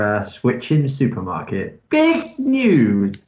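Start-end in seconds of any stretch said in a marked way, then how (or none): 1.9-3.2 high, loud news-flash voice; mock announcer. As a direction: announce it like a headline.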